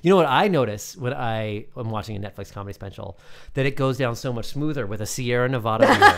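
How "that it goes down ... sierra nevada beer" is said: mock ad read. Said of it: "That came off natural, right?".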